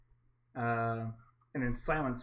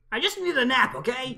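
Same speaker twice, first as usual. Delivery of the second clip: high squeaky little critter; high creature voice; 11 semitones higher, 7 dB louder than usual. Acted. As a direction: squeak it out like a tiny glider. GM voicing Squawks.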